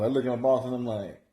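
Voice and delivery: normal talking voice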